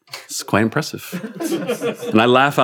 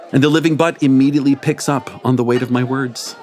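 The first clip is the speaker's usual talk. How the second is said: ordinary delivery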